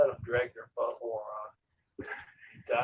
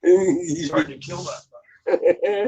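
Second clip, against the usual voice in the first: shouting; 11 semitones higher, 12 dB louder, slower than usual. Real, not acted. Loud outburst about tough animal.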